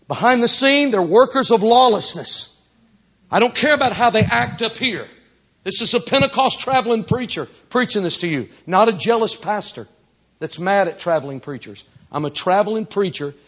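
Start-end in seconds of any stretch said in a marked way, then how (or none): 0.1-11.8 high, insistent pulpit warning; forceful preaching. Real, not acted. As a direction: press the warning on the congregation.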